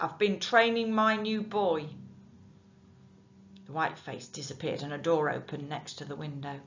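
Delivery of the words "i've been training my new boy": shouting. Acted. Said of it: "Nick shouted back".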